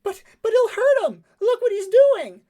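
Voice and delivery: high, loud panicked cry. Acted. Cleven turning back to his companion, panicked shout. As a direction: protest in a panic, voice shooting way up. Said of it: "his face panic".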